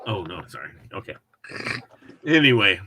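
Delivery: ordinary talk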